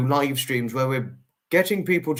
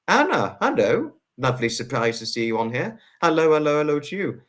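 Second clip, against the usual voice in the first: ordinary talk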